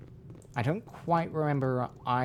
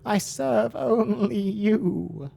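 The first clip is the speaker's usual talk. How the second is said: higher, begging goat voice; 8 semitones higher, 4 dB louder, slower than usual. Acted. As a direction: beg for forgiveness, higher. Goat servant begs, higher.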